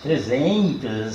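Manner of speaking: slow duzentos with a stretched I, like duzentio; caipira drawl. Shown as a demonstration. Storyteller shows the caipira way of saying numbers. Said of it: "esse I será que tem".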